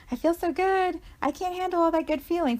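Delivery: overwhelmed by good feeling. Acted. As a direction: play at being too full of good feeling. Acted overwhelm at feeling too good.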